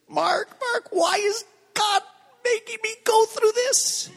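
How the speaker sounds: high, pleading imitation of his scared wife; panicky wife. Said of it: "And she says".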